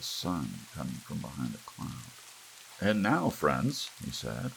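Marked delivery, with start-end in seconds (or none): 2.8-3.9 slow, loud, drawn-out pitchman opener; ad announcer. Acted. TV announcer opening a commercial, slow and booming. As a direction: hook the viewers like a TV host.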